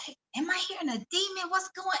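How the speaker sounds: high, hushed reenacted panic; alarmed thought, squeaked softly; freaked out. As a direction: act out a startled, high-pitched inner freak-out. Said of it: "I was freaking out".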